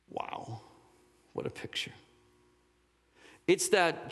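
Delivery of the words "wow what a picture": hushed, voiceless wonder; soft, breathed-out awe; awed whisper. Retold or imitated. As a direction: whisper it in amazement. Retells own awed reaction to movie.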